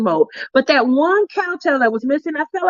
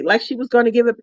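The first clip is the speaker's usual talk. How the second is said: usual speaking voice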